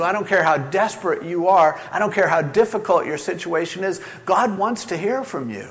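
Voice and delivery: ordinary delivery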